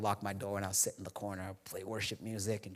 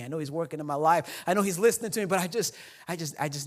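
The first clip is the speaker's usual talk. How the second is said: rising louder and higher, near tears; emotional outburst; 7 semitones higher, 8 dB louder than usual. Retold or imitated. Pastor telling his friend he just needed a hug, voice breaking louder. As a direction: build to a pleading break.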